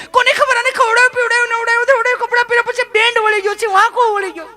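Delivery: high, loud mock panic; mimicking frantic mother. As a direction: shriek it out like a scared mum.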